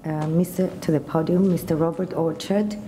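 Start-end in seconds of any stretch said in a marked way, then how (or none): none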